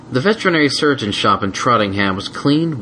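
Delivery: usual speaking voice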